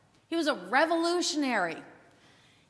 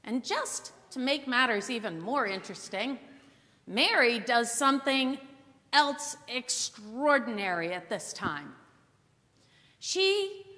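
ordinary talk, nothing marked

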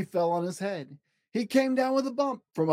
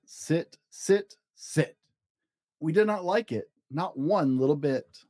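lightly read-aloud picture book narration; story narration. A narrator's reading. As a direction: read it to a child, rhythmic and even.

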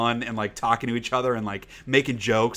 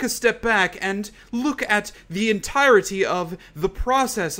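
imitating the twins; 10 semitones higher than usual. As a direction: ham up a pompous reality-show twin. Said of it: "everything they say is like this".

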